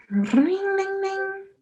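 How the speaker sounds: high chiming dings rising up; imitating the bell. Showing how the dog rings the bell, high chiming dings. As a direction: ring it out like a bell. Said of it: "So he goes".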